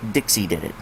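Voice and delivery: plain reading voice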